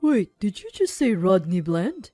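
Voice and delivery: changed tone. A narrator's reading. Conrad reacts to the fiancé's name, taken aback. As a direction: pull up short at a familiar name. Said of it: "Conrad's tone changed".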